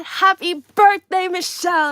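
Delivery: high, very loud birthday shout; huge, high-pitched exclamation; exclamation in capitals. Acted. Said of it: "An exclamation, capital letters".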